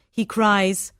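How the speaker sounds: usual speaking voice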